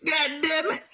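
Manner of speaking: ordinary talk, nothing marked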